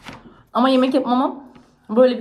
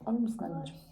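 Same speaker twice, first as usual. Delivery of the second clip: soft coaxing; 2 semitones lower, 11 dB quieter than usual. Real, not acted. Asking child softly.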